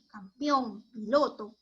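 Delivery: ordinary talk, nothing marked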